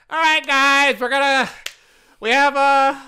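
rally the staff like a twinky manager; high chirpy mock boss announcing the day; mock young boss.

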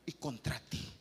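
son's humbled plea, low and hushed; humble plea. Acted. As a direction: drop low as the son begs. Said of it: "¿Y qué dijo el hijo pródigo?".

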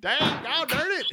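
put-on high, loud old prospector yelp; gruff mountain man. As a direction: ham up a crusty old miner.

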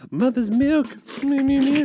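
put-on very high whiny baby. Mocking Brits as babies wanting milk, high baby whine.

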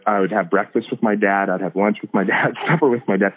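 ordinary delivery

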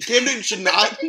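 loud, high, worked-up rant; indignant sports-fan outburst; fired up. Real, not acted. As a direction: get fired up, punching it out loud. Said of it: "I'm getting fired up again".

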